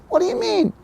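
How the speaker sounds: very high mock-indignant question; startled daughter. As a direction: shoot up in pitch, imitating a taken-aback daughter. Acts daughter's startled reply.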